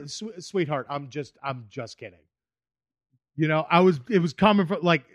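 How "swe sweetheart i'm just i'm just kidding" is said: softly, sincerely, fading away; a quiet sheepish sorry; honest soft apology. Retold or imitated. Comic to upset woman, quiet sincere apology. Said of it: "but I was just honest".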